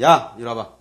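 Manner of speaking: loud barked "이리 와봐"; cold loud command; harsh summons. Shown as a demonstration. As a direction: order them over without love. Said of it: "사랑하지 않으면".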